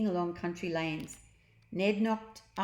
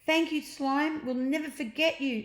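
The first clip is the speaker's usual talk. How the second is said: put-on grateful boy; 8 semitones higher than usual. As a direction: thank the blob goodbye. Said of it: "said Ned".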